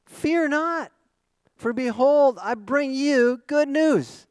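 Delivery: high, ringing announcement, slowing toward the end; angel's proclamation. Acted. Angel announcing good news to shepherds, proclamation. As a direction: proclaim it to a frightened crowd. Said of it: "And the angel said to them".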